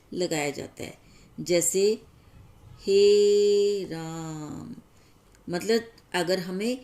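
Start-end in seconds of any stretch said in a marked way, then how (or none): 2.8-4.7 हे राम with the vowel of हे held very long and slow; stretched pluta vowel. Shown as a demonstration. Teacher demonstrating a pluta vowel to students, very long held vowel. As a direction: draw out हे राम, holding the vowel like a call. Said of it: "उसको हम देर तक बोले".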